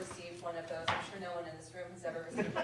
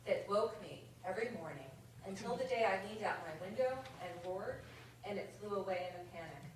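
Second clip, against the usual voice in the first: measured, rhythmic verse reading; comic poem read to an audience; 5 dB louder than usual. Acted. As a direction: read the light verse line by line, savouring rhymes. Reads a comic poem aloud.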